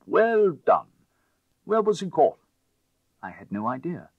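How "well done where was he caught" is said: higher, loud, delighted; excited praise. A narrator's reading. Lord praising the narrator's catch, loud and high. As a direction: cheer the capture loudly.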